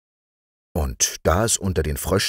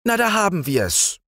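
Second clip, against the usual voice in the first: very high exclaiming call; the prince hearing the coronation bells; frog prince calling; 14 semitones higher than usual. Acted. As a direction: exclaim it with pleased impatience. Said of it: "rief der Prinz".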